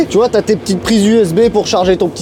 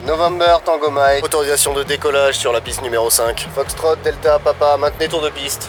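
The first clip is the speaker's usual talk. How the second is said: pilot cockpit headset; 5 semitones lower, 5 dB quieter than usual. Pretend. Joue le contrôleur aérien.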